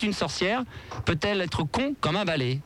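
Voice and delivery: slow careful repetition; slow repeat. Shown as a demonstration. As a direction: repeat it slowly for listeners. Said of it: "Je répète". (répétition lente)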